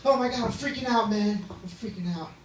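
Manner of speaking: high, loud fake freak-out, easing off; staged panic. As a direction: play up a panic attack for attention. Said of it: "I staged panic attacks".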